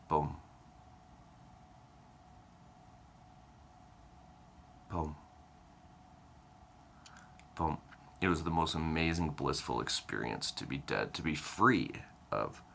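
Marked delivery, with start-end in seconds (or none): 0.1-7.8 imitated heartbeat, single thuds with long gaps; slowing heartbeat. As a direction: sound out a heart nearly stopping, one beat at a time. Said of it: "My heart slowed down. It went".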